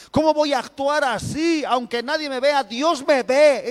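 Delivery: high, loud preacher's cry, pressing each phrase; fiery preaching. Acted. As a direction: preach it out to the congregation at full force. Preaching at full force. (predicando con fuerza, voz alta y elevada)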